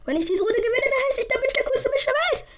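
high, loud and fast; excited high voice. Real, not acted. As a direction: hype yourself up. Hyped bet on winning.

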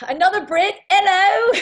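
high, loud hammed-up British; a posh British hello; mock British accent. Greeting a Brit in accent.

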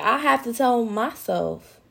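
ordinary delivery

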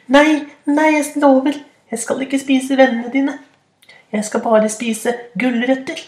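very high squeaky begging; tiny troll pleading. Acted. (pipende bedende troll)

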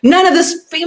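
high, fast, pressing inner voice; urgent inner panic. Retold or imitated. Someone facing death crying out what matters. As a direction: blurt out what really matters, rising in alarm.

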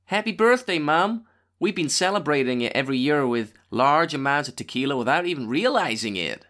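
put-on American accent, birthday greeting. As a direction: read it out like an American. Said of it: "Do an American accent then".

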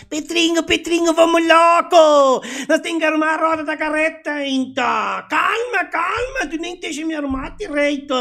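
put-on shrill, loud German-accented farmer yelling; German accent shouting. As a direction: bellow it in a thick German accent. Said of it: "brincando com sotaque alemão".

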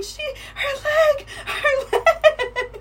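very high, squealed mock panic; imitated screaming brother. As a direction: shriek it in alarm, half laughing. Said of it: "my brother's screaming".

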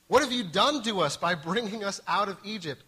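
the terrified people blaming Moses, loud accusing cry; Israelites' outcry. A narrator's reading. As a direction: hurl it at Moses as frightened people.

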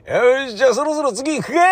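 high, hearty rallying; festival leader. Retold or imitated. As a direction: move the group on. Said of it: "かなんか言って".

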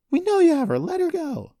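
very high, loud yelling; furious accusation. A narrator's reading. Daughter to suspected kidnapper while held back, furious yell.

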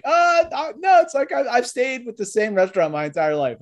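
squeaky high voice of a lifelong one-restaurant employee; mock loyal worker. Host mocking a lifer waiter, high squeaky voice. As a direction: lampoon someone proud of one job forever. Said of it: "They're like".

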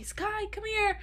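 high-pitched imitated calling out to the dog. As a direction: owner calling her escaped dog, mock frantic. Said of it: "you just hear me".

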